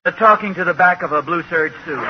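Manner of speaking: ordinary delivery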